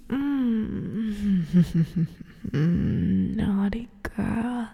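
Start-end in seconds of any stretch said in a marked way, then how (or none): 2.1-3.3 breathy wicked chuckle; whispered laugh. Acted. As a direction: laugh under the breath, pleased. Relishing victim's fear.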